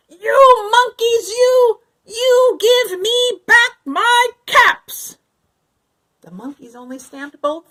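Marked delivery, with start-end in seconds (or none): 0.1-5.2 high, loud, very slow yell; very angry shout. A narrator's reading. Peddler shouting at the monkeys at his angriest. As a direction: howl it with both feet stomping. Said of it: "he shouted".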